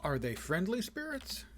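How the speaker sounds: high-pitched cartoon line with a rising question; cartoon quote. Gamer tossing viewers an old cartoon reference, cartoonish quote. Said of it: "That's an old cartoon reference".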